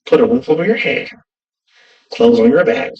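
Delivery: normal talking voice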